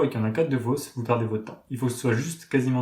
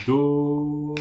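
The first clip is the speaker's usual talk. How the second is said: held note; 3 semitones higher than usual. Shown as a demonstration. Teacher holds Do for students for two beats. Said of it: "On va attendre deux temps".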